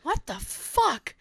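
slow, quiet baffled question; startled disbelief. Retold or imitated. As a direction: whisper it, spooked and confused. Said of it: "And I was like".

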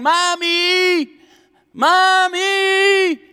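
put-on high, loud wailing child calling out; child screaming. Pastor imitates a child calling in a store, high loud wail. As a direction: cry out like a lost child in a store. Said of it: "a child screaming or yelling".

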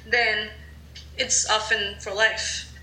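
normal talking voice

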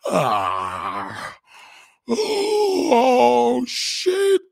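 high, loud wailing cry; hammed-up climax cry; mock cry out. A comic imitating his noisy girlfriend.

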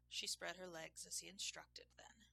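usual speaking voice

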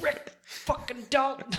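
slow, high-pitched fanboy gush; gushing excitement. Real, not acted. Film fan gushing to friends, slow and high. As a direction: savor each word like a star-struck fan. Gushing over Rick Dalton.

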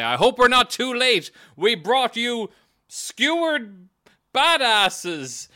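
high, loud, cheerful farmer voice; loud high-pitched cheery farmer; proud farmers. Acted. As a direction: announce it like a proud rustic gang. Farmers proudly announce arrival.

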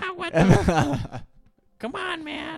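very high squeaky kid impression; hammed-up high-pitched tough guy; Dog the Bounty Hunter.

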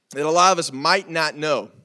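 ordinary talk

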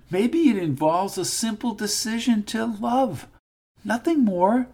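a priest gently preaching; gentle priest voice. Acted. Priest lecturing gangster.

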